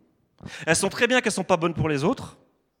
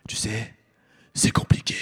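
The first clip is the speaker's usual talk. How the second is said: whisper; 8 semitones lower than usual. Retold or imitated. Cousins answer evasively.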